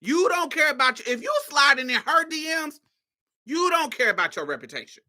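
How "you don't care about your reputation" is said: usual speaking voice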